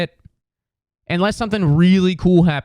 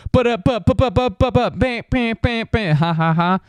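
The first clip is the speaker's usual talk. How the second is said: high, voiced sound-effect burst; mock button noises; soundboard noises; 9 semitones higher than usual. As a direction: imitate a soundboard going off. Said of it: "you can just go".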